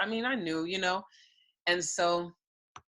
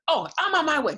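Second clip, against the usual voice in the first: urgent friend; 11 semitones higher, 7 dB louder than usual. Retold or imitated. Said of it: "she was like".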